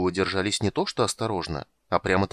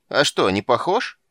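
put-on louder, touchy old man; offended; 4 semitones higher, 6 dB louder than usual. A narrator's reading. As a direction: take it as a slight. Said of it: "обиделся старик".

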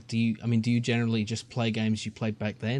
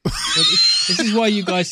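loud outburst; 8 semitones higher, 8 dB louder than usual. Acted. Mock indignation bursts out.

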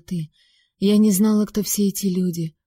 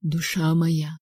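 softer; 2 semitones lower, 3 dB quieter, slower than usual. A narrator's reading. Stranger softly coaxes the frightened girl, tender. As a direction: coax her gently, like a lover. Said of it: "произнёс более мягко".

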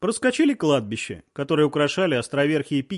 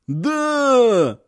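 higher, loud wail; crowd howling; 7 semitones higher, 4 dB louder than usual. A narrator's reading. The women's crowd howls agreement, higher and loud. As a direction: howl it as a whole crowd. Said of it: "уже вопила толпа".

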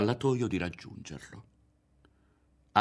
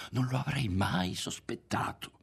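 higher, odd, altered voice; strange timbre; 5 semitones higher than usual. A narrator's reading. Berto confessing his surprise, in a strange voice. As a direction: make the voice oddly unfamiliar. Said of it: "con un timbro di voce strano".

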